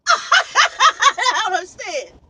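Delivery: drawn-out, high shriek of disbelief; laughing shout. Real, not acted. Trucker baffled by the schedule, shouting through laughter.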